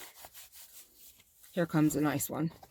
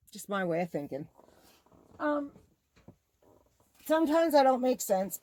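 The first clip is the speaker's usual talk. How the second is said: ordinary talk, nothing marked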